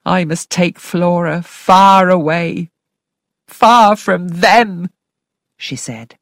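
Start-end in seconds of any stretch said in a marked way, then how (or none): 0.1-4.9 slow, drawn-out anxious housekeeper; determined but frightened, slowly; Mrs Grose voice. A narrator's reading. Housekeeper resolving to take Flora away, slow and earnest.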